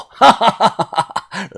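癫狂的一串哈哈声; 失控似的放声狂笑; 疯狂大笑. A narrator's reading. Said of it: "他疯狂的大笑". (疯子般的大笑)

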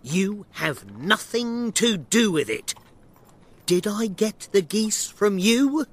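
angry shouting. Acted. Shopkeeper tired of questions about geese, angrily telling the man off. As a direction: scold him with rising indignation. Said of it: "Breckenridge shouted".

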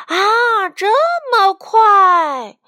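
high, loud cry of protest from a chick; voiced chick protesting loudly. A narrator's reading. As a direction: exclaim it high, drawing out the complaint. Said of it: "小鸡们抗议道".

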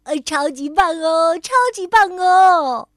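loud, high, jubilant cheering; thrilled kid celebrating at full volume; excited cheer. A narrator's reading. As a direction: cheer it out, jumping for joy. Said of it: "蹦蹦兴奋得手舞足蹈".

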